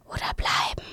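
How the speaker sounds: breathy gusting whisper of the wind; windy whisper. Acted. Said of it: "flüsterte der Wind".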